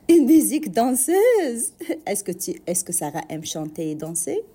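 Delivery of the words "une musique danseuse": surprised echo. Real, not acted. Host surprised at child's answer.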